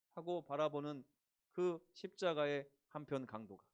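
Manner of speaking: usual speaking voice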